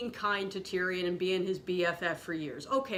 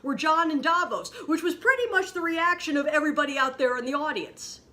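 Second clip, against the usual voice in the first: angry rant; 5 semitones higher, 4 dB louder than usual. Real, not acted. Escalating rant about the show.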